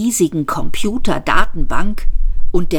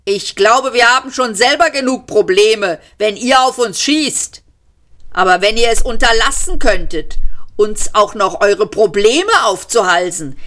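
raised, higher shout; bellowing; 7 semitones higher than usual. A narrator's reading. Ford yells his complaint across to the police, raised and high. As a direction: holler across the room, pleading. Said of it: "Ford brüllte los".

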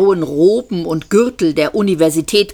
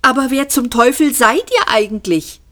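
angry shout; 7 semitones higher than usual. A narrator's reading. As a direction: shout the question furiously. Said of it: "schrie Fug wütend". (hoher, lauter, wütender Ruf)

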